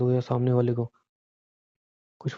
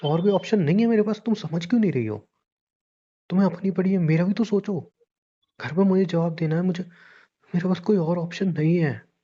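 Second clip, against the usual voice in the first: high, agitated pleading argument; exasperated; 6 semitones higher than usual. Acted. Man insists to partner he has no option, exasperated. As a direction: argue desperately, pitch jumping up.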